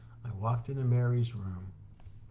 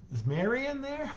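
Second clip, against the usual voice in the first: calling out; 14 semitones higher than usual. Retold or imitated. Calling into crowded room.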